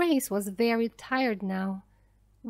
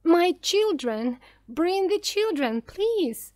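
high-pitched desperate plea; mother's mock scream, voice lifted high; 8 semitones higher, 4 dB louder than usual. A narrator's reading. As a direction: beg urgently in a high, narrated cry. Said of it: "She was screaming".

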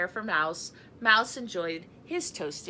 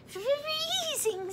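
high squeaky cold mouse voice; little mouse shivering; chattering mouse. A narrator's reading. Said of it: "said Mouse with chattering teeth".

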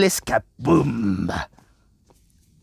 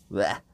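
disgusted bleh; 11 semitones lower, 9 dB quieter than usual. Real, not acted. Disgust at smelly beetle house.

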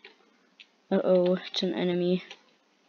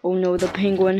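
normal talking voice

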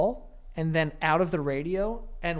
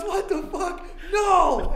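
laughing shocked quote; 11 semitones higher, much slower than usual. Retold or imitated. Same guy, laughing.

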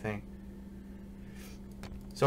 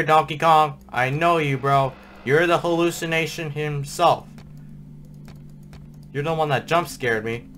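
normal talking voice

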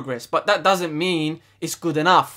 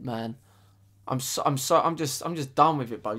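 usual speaking voice

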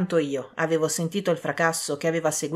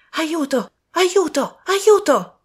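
rising desperate cries, each higher and louder; cries for help; 11 semitones higher, 7 dB louder, much slower than usual. A narrator's reading. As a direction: call for help in growing panic. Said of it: "una voce spenta a gridare tre volte affrettatamente". (grida d'aiuto sempre più disperate)

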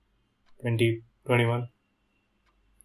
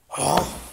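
pained breathless sigh; heavy sigh; 6 semitones higher, 5 dB louder than usual. Acted. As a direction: exhale hard through the pain. Exhausted after falling hard.

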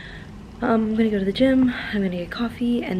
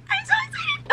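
excited squeal; 4 dB quieter than usual. Real, not acted.

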